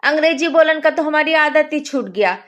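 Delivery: loud rustic villager telling a story; loud rural Hindi storyteller; rustic village accent. Acted. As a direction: boom it out like a village uncle. Rustic man tells tea stall story.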